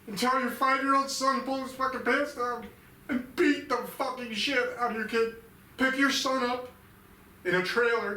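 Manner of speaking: enraged yell at his father; furious confrontation. Retold or imitated. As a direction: scream the accusation at his dad. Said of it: "I just wanted to say to my dad".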